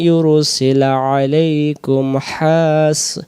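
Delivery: slow, chanted Quran recitation with drawn vowels. Shown as a demonstration. Teacher reciting the verse for students, slow and chanted. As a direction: recite the verse slowly, each vowel held.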